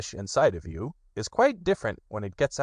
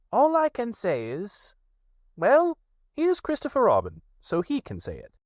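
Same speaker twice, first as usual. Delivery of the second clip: high, pleased rabbit voice; 12 semitones higher than usual. Acted.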